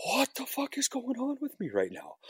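high bewildered murmur, confused; lost and high-pitched, asking himself; bewildered inner voice. Retold or imitated. Inner confused cry quoted.

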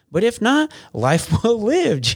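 laughing uplift. Real, not acted. Laughing payoff of life advice.

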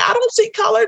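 very high, innocent mock voice; mock naive voice. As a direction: parrot the cliché sweetly. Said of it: "that you have been saying".

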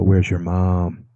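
slow, loaded, leading question; ominous dad question. Shown as a demonstration. Dad to his kids, slowly and ominously. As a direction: let it hang like trouble is coming. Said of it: "I look around, I go".

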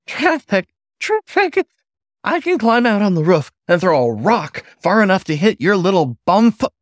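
very high, loud, exasperated rant; kettle whistle voice. Acted. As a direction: squeal in disbelief, building. Furious manager, kettle voice.

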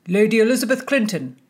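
loud, fast herald call; shouted announcement. A narrator's reading. A groom announces the lady to the room, loud call. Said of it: "a groom shouted out".

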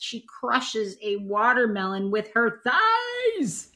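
slow build to a high, stretched THIGHS; amazed raving. Real, not acted. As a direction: rave slowly, ending on a long high note. Raves about an outlandish guest.

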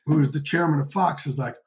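ordinary talk

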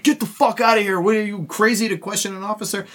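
high-pitched mock outrage; indignant soldiers. Soldiers telling off the drunk who questioned an officer, high mock indignation.

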